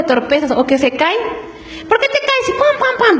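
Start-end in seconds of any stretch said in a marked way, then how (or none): 1.9-3.2 much higher mock scolding with hitting noises; angry parent. Preacher mocks a parent hitting a child who fell, hammed-up high scolding. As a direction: ham up the exasperated parent smacking the kid.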